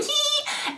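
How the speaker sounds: very high, soft mock squeal; child's giggle noise. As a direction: mimic a little brother's gleeful "hee". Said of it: "I'm sitting here laughing".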